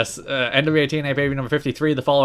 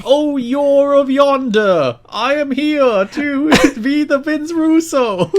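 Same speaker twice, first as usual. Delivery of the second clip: much higher, slow, loud hammed-up fantasy voice; mystical fantasy character; 12 semitones higher, 5 dB louder, slower than usual. Mocking the accent as a D&D character voice, high and slow. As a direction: play a grand old-timey mystic, slowly. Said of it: "the voice he gave whatever mystical character".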